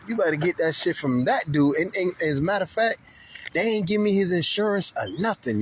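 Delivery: ordinary talk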